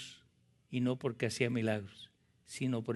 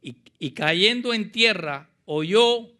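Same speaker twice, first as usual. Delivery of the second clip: loud, raised-pitch dramatic Bible reading; preacher reading scripture; 4 semitones higher, 8 dB louder than usual. Acted. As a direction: build the reading with mounting force.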